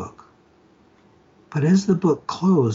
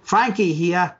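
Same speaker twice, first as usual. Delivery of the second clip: put-on Australian accent; 6 semitones higher, slower than usual. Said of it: "a thick Australian accent".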